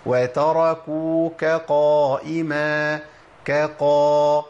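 slow chanted "وتركوك قائما" with a light kaf, then heavy qaf; careful kaf before qaf. Shown as a demonstration. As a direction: recite "كقا" slowly, keeping kaf thin and qaf full. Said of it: "خد بالك".

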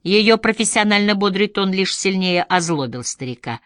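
usual speaking voice

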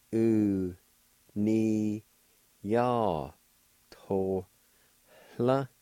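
Tohla with a faint h before the l; syllable by syllable. Shown as a demonstration. Teacher showing the faint H sound. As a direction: sound out U ni ya, then Tohla with a breathy h. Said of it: "It's pronounced like this".

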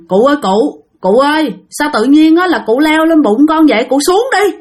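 put-on father startled, begging the old man to climb down; startled plea. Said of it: "Thì ba mới nói rằng".

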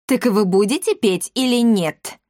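testy, pressing question; impatient. A narrator's reading. Ghosts pressing visitors to sing, impatient. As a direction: demand an answer with rising insistence. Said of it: "нетерпеливо спросили привидения".

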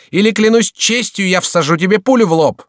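high, loud threat; furious, threatening yell; menacing shout. A narrator's reading. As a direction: shout it as a final warning. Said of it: "продолжал кричать грозным голосом".

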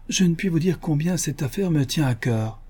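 mildly agitated little man; feverish eagerness. Acted.

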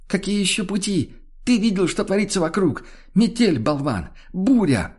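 high, furious retorts; exasperated shouting. A narrator's reading. Woman in the storm answers stupid question, exasperated. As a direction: snap back as if at an idiot.

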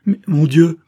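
loud, high cry of dismay; acted shock, loud and high; anguished exclamation. A narrator's reading. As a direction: burst out in distress, pitch jumping up. Horrified reply to grave news.

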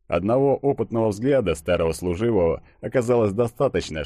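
ordinary delivery, nothing marked

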